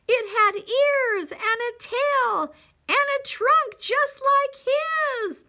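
building from measured suspense to high, loud delight; story read to children. A narrator's reading. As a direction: build to a thrilled reveal. Reads picture book to children.

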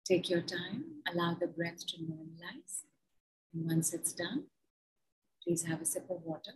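soft, gentle settling instruction; soothing. Real, not acted. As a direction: bring them back down softly. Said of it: "soothing after breath exercise".

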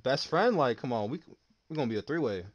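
ordinary talk

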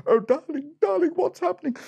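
very high, fluttery princess falsetto; hammed-up damsel in distress; panicked princess. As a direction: cry out to the prince in alarm.

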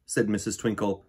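ordinary delivery, nothing marked

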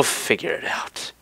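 whispered aside; whispering. Real, not acted. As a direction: drop to a whisper. Aside at end of outro.

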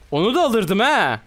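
high, loud and incredulous, slow on hayırdır lan; indignant shout. Real, not acted. Streamer yelling at an enemy who dared to fight him, loud and high. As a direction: yell at the screen in disbelief. Angry outburst at enemy in game.

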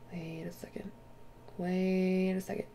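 hushed whisper. Real, not acted. Vlogger whispering, spooked by the game. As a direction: breathe it out in a whisper.

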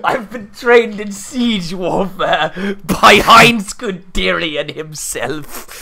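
very slow, grandiose villain voice; Grievous. Pretend. Player boasts of siege training, slow villain voice. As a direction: boast like a villain, drawing out every word. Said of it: "a Grievous voice".

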